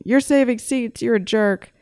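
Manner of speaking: high, snippy imitation of annoyed strangers; lightly mocking accusing voice; mock accusation. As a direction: mimic judgmental people. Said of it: "of people thinking".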